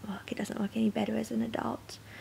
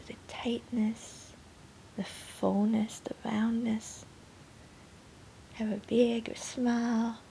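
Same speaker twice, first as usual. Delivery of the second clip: gentle, unhurried guidance, fading softer at the end; guided meditation; 8 dB quieter than usual. Real, not acted. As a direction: softly guide them into feeling the body. Leads pregnancy meditation.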